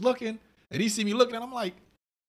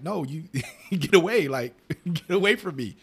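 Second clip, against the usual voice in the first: slow, louder, laughing through it; laughing mock brush-off; 3 semitones higher, 5 dB louder, slower than usual. Speaker laughing as he shoos away a stranger. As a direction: shoo them off while laughing. Imitating telling someone off, laughing.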